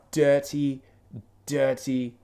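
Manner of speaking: "dirty" drawn out loudly in a British accent; dropped T's. Shown as a demonstration. Showing friend his rough accent, "dirty" stretched out. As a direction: say "dirty" slowly with a slurred British T. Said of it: "they don't really pronounce their T's".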